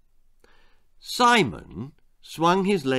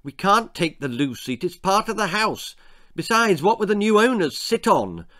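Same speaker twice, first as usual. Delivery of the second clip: mum voice; 7 semitones higher, 4 dB louder than usual. A narrator's reading. Mum arguing with dad.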